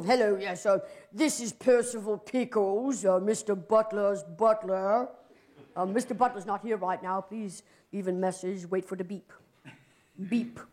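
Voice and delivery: posh butler voice. Acted. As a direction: announce yourself as a stuffy butler on the answering machine. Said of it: "a little more severe and more, posh, professional".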